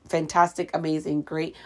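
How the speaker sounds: ordinary talk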